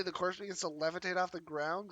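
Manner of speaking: normal talking voice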